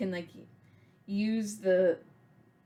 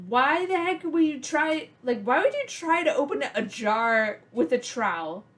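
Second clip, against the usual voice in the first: raised, exasperated mock scolding; a hint of a fed-up grandma; imitating an annoyed grandmother scolding a child; 5 semitones higher, 4 dB louder than usual. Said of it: "She was so mad at me".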